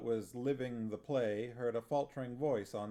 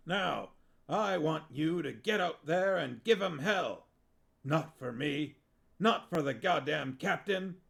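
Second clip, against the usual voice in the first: war-film sergeant pep talk; 5 semitones higher, 5 dB louder than usual. Acted. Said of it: "and lowered his voice".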